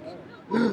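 a sharp, high mock gasp of horror; shocked gasp. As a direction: gasp as if scandalised. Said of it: "It was like".

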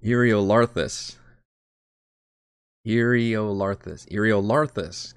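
Eriolarthus sounded out slowly, "ear-ee-oh-LAR-thus"; "Eriolarthus" drawn out with the weight on "LAR"; stress on LAR. Shown as a demonstration. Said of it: "the emphasis on the syllable".

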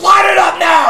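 high, furious shouted order; going crazy. Retold or imitated. Coach ordering reluctant smoker to light up, crazed shout. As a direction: bellow it like a coach losing it. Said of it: "he starts going crazy on him".

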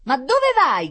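high, loud call after someone; shouted question. A narrator's reading. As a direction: yell after the runaway. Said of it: "gridò il signore".

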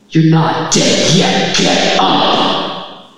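loud, slow, commanding voice from above; booming heavenly voice. Retold or imitated. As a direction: thunder it down like a god. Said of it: "a voice that, like, vibrates through your entire body".

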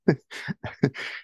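a choked, breathy snort of held-in laughter; stifled laugh. Retold or imitated. Dad at a sad moment, imitated stifled laugh. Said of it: "because he was trying not to laugh".

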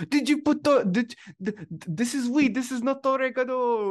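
high, loud mock Italian American accent; hammy Italian American movie accent. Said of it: "trying to pronounce oregano in his Italian American accent".

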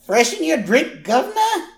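put-on very high, loud British accent; hammed-up British barmaid squawk; British waitress. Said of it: "Teeth are very bad".

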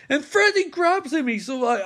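usual speaking voice

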